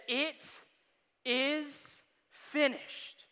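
slow, drawn-out solemn reading; solemn last words. Acted. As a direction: let each word land slowly.

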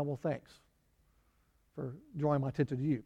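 soft sarcastic thanks, lightly voiced; sarcastic beggar. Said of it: "he's probably thinking".

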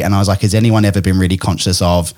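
ordinary delivery

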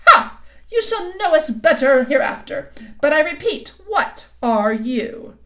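haughty anger. A narrator's reading. Queen threatens Edmund.

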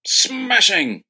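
high, hammed-up British "Smashing"; mock British exclamation. Americans mocking British slang, high and hammed-up. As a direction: squeal "Smashing" like a posh Brit. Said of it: "British slang words".

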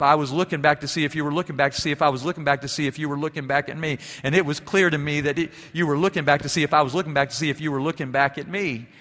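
fast, tumbling run of looking back; playful quick recital of a country song lyric; rattled-off lyric. Retold or imitated. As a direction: rush through the song words in one breath. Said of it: "a country and western song that my father used to play".

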